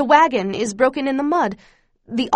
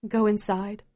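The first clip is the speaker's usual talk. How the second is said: tired. A narrator's reading. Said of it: "Ma tells us in a tired voice".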